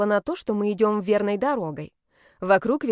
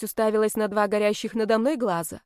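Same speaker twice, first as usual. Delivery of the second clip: ordinary delivery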